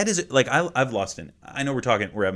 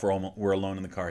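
ordinary talk

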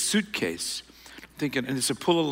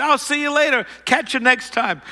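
much higher, cheery send-off, covering up; breezy fake goodbye; 10 semitones higher than usual. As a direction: wave him off as if all is fine. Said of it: "I'm gonna fake it".

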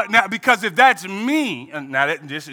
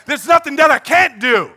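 louder, commanding voice of God; God declaring; 3 semitones higher, 4 dB louder than usual. Acted. God answering the crying faithful, louder and commanding. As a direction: proclaim it as God. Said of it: "and God says".

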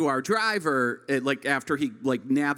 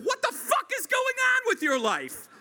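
much higher mock scolding mother; hammed-up furious mom; shrieking parent, played for laughs; upset parent. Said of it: "They're rather upset".

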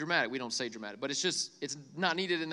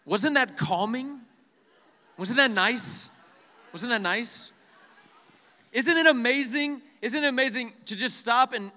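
excited; 6 semitones higher, 7 dB louder than usual. Acted. Upbeat preacher asking crowd.

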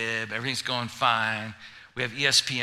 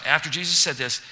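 ordinary talk

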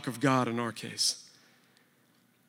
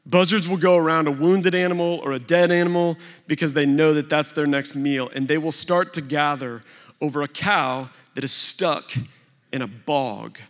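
ordinary delivery

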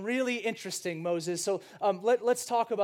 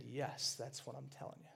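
quiet reply; 7 semitones lower, 16 dB quieter than usual. Pretend. Moses answers Stephen quietly.

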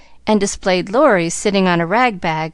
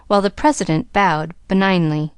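ordinary delivery, nothing marked

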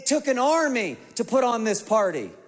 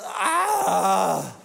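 whiny groan. Retold or imitated. Dad imitating his son groaning at dish duty, whiny groan. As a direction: groan like a ten-year-old asked to help.

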